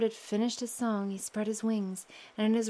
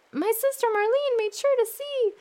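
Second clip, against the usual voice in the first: much higher, louder bird chant; bird's song; 13 semitones higher, 6 dB louder than usual. Acted. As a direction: sing it high as the bird. Bird sings again.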